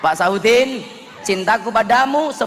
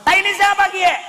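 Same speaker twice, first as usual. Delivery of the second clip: much higher and louder Ngapak shout; loud Ngapak cry; 6 dB louder than usual. Retold or imitated. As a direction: yell it in alarm with a Tegal accent. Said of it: "Akhirnya begitu masuk".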